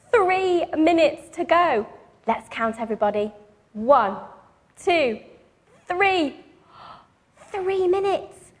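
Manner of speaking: ordinary delivery, nothing marked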